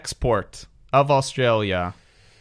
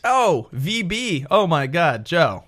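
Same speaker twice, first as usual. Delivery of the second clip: drawn-out, higher delighted oh; fond recognition; 6 semitones higher, slower than usual. Real, not acted. Host delighted the cohost drank his beer, fond recognition. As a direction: relish the memory of the beer.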